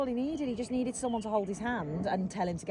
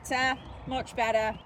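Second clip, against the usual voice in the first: called out praise; 8 semitones higher, 4 dB louder, slower than usual. Real, not acted. Coach calling out to rider.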